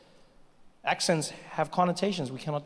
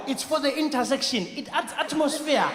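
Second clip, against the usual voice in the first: African guy voice; 9 semitones higher, 3 dB louder than usual. Retold or imitated. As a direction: explain it as decoration. Guy explains traffic lights.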